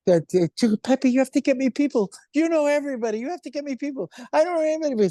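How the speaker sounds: very high, insistent mock nagging; imitated pleading. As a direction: mimic a friend begging for help. Acts friend pleading for guests.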